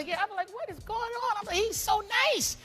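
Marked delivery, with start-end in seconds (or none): 0.5-1.4 high, softer puzzled cry; squeaky bewilderment; baffled. Retold or imitated. Retold amazement at gifts. 1.5-2.6 high, slow gushing; hammed-up sweet admiration; touched. Retold delight at gifts.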